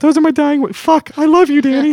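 high falsetto woman's voice; man doing a woman's voice. Pretend. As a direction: squeak it out like a girlfriend on the phone. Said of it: "Do you like my girl voice?".

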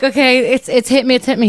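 high, loud flustered announcement; urgent retelling of sudden panic; mock panic. Acting out the high hitting her.

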